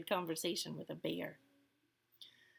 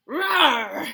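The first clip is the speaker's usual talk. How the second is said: loud, raised bear roar; bear growl; 6 semitones higher, 11 dB louder than usual. A narrator's reading. Old Bear answers Baba Braina about the nuts, growling. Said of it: "growled Old Bear".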